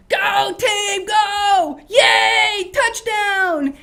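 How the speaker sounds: full-throated stadium shout; loud cheer. Shown as a demonstration. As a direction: yell for your team in the stands. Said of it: "you go".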